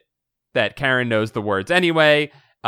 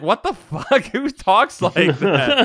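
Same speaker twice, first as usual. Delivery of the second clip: high-pitched, incredulous laughing outburst; incredulous, cracking up mid-question; laughing disbelief; 7 semitones higher, slower than usual. Retold or imitated. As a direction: react in baffled disbelief, breaking into laughter. Incredulous reaction to odd writing.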